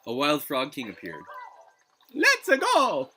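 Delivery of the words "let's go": put-on high, loud Italian-accented cheer; hammed-up Italian cartoon plumber; Mario voice. Mario impression in game.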